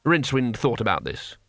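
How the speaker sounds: ordinary delivery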